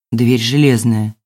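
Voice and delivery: low, slow and dejected; glum. A narrator's reading. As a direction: say it with a weary sinking heart. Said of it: "тоскливо проговорил тот".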